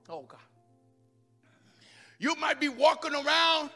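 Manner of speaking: usual speaking voice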